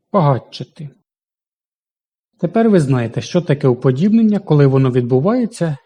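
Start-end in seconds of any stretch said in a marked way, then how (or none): 0.1-0.9 багатшати said quickly, тш merged into a long ч; fast tempo. Shown as a demonstration. Teacher showing the class the fast-tempo pronunciation. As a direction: rush багатшати so тш fuses into a long ч. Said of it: "У швидкому темпі".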